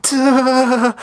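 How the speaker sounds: high sharp comic clang noise; a cartoon metal bonk, pitched high. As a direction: spear clanging off the ceiling, voiced high. Voicing the clanging spear.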